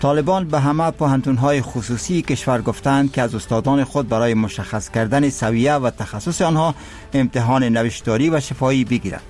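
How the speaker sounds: measured formal news read; solemn formal newsreader. Acted. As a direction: read the headlines with gravity. News bulletin read by anchor.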